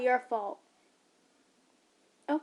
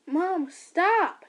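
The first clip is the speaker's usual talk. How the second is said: loud, high plea; urgent shout; 6 semitones higher, 8 dB louder than usual. Acted. Son shouting while pulling mother off. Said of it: "he said, pulling".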